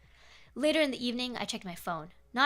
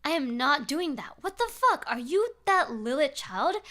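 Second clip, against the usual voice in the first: almost shouting; 4 semitones higher, 5 dB louder, slower than usual. Acted. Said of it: "I almost shouted back at him".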